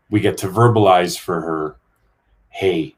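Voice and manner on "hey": normal talking voice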